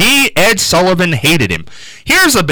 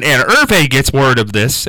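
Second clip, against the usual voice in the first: usual speaking voice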